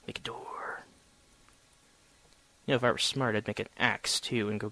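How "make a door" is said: unvoiced aside; whisper. Real, not acted. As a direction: whisper to yourself.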